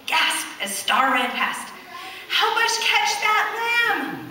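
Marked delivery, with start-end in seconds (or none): 2.3-4.3 high, slow calling voice; slow high shepherd's call. A narrator's reading. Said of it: "a shepherd called".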